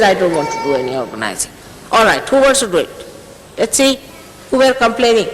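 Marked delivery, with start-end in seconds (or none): 0.0-1.5 lower, mocking take on a reluctant helper; lightly voiced sulky refusal; imitating Warren. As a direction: drop the voice low and mock his excuse. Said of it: "He says".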